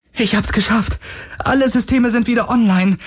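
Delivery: high, eager report; proud, breathless relief; triumphant. Acted. Kowalski telling Parker she succeeded, high and eager.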